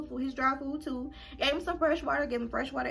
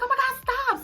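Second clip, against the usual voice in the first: high protest; 5 dB louder than usual. Retold or imitated. Sister calls her a murderer.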